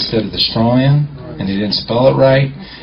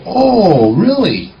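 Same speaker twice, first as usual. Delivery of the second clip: mock surprise; 8 semitones higher, 3 dB louder, much slower than usual. Retold fake shock at the clerk.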